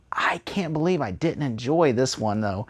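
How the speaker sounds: hushed, breathy aside to himself; whispered inner thought. Retold or imitated. Reviewer voicing his own thoughts to viewers, whispered. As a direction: whisper it like a guilty confession. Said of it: "And you're just like".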